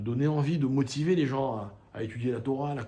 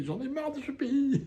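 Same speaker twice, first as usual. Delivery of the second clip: much higher, whiny mock-tearful wail; imitating a crying woman; 11 semitones higher, 3 dB quieter than usual. Teacher acts out the woman on the bus, mock-tearful wail. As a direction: play the fed-up immigrant close to tears. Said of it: "elle s'est mise à pleurer".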